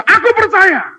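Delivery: high shouted cry of faith. Acted. Desperate father answering Jesus, high shout. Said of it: "Langsung dia berkata".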